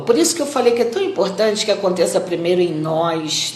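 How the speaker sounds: ordinary talk, nothing marked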